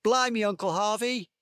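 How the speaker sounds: shouting. Acted. Boy pranking his uncle over morning tea, shouting. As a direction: call it out like a cheeky prankster.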